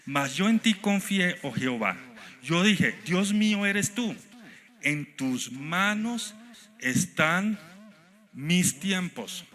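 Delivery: high, loud, slow psalm proclamation; impassioned scripture reading. Real, not acted. Pastor reads Psalm 31 aloud to the church, fervent and drawn out. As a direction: cry the psalm out to the congregation.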